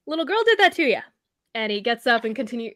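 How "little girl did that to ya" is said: a touch higher, teasing; lightly voiced mocking dwarf. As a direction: needle the beaten fighter lightly. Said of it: "he goes".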